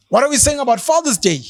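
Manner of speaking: ordinary talk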